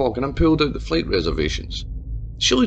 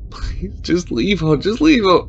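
slow, pleading, rising to louder; sobbing plea; 5 semitones higher, 4 dB louder, slower than usual. A narrator's reading. Mayor begs Tabitha through sobs. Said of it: "The mayor sobbed".

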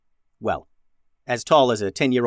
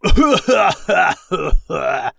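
big mocking alien cackle; grumbly, slimy belly laugh; loud villain laugh; 7 semitones higher, 7 dB louder than usual. A narrator's reading. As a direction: laugh loudly in the creature's voice. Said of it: "Captain Goob began laughing loudly".

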